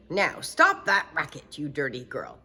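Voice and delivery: loud scolding turning to shock; father realizing it's his daughter; stern father. Acted. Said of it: "Her father opened the door and said".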